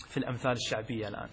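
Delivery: ordinary talk, nothing marked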